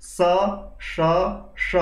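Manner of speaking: স said three ways: dental, retroflex, palatal; three sibilants. Shown as a demonstration. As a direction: give each স its own place of articulation. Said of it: "তিনটি স আছে". (তিন রকম স উচ্চারণ)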